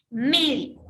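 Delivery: "Médico" with a loud, drawn-out MÉ; stress on first syllable. Shown as a demonstration. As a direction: lean hard on MÉ in "Médico", dropping away on the last syllables. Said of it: "ahí está la mayor fuerza de voz".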